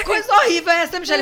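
high, loud, laughing mock outrage; mother voiced high and loud. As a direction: squeal the mother's complaint laughing.

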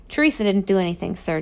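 ordinary delivery, nothing marked

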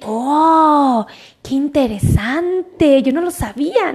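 drawn-out, slow acted marvelling; impressed layperson. Layperson hearing science explained clearly, acted slow amazement. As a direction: draw it out like someone just learning something. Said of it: "puedan decir".

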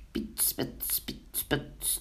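whispered spitting sizzle; sizzling butter sounds. A narrator's reading. Narrator imitates butter and batter frying, whispered sizzle. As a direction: imitate batter sputtering in the pan. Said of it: "deed het beslag weer in de pa".

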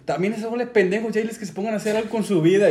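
high-pitched mock dad tirade; scolding dad. Dad berates his son's gaming friends, mock scolding. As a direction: lay into all the friends, quickening as you go. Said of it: "lo pendejea a él y a todos".

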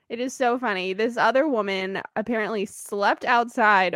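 ordinary talk, nothing marked

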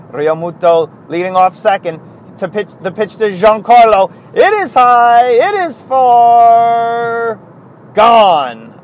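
loud, high home run call, stretched out. Pretend. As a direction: call it like a play-by-play announcer. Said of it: "Such as".

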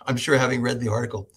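ordinary talk, nothing marked

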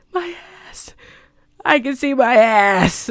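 hammed-up horror, building from faint to full voice; Meryl Streep. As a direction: begin tiny and high, then cry out the last line. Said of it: "the perfect, you know, Meryl Streep way".

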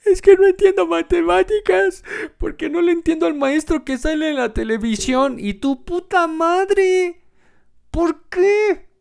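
put-on a child wailing to his mother; whiny crying kid. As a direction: whimper it like a sulky little kid.

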